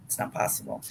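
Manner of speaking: whispered under her breath. Real, not acted. Repeating to herself that it can't be done, whispered. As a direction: drop to a bare whisper, voice gone.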